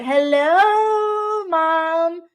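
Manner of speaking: high, sing-song hello to mom; chirpy hello, Mom; playful greeting. Real, not acted. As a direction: call out brightly to your mother. Greets her mom watching.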